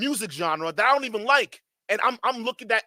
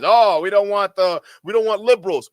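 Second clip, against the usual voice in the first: mocking right-winger quote; 3 semitones higher, 7 dB louder than usual. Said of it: "Just like these guys".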